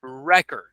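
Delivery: 「Record」の頭を強く、REC-ord; noun stress. Shown as a demonstration. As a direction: stress REcord on the first syllable. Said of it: "we would pronounce it like this".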